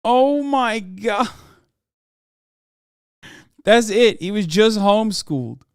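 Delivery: high, loud incredulous outburst; loud disbelief. Real, not acted. Streamer stunned by a homeschool-only education, loud disbelief. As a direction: cry it out, astonished.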